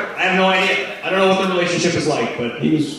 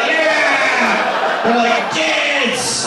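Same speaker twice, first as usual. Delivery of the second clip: crowd cheer; 11 semitones higher, much slower than usual. Retold or imitated.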